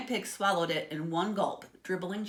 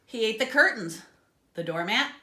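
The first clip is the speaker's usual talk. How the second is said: ordinary delivery, nothing marked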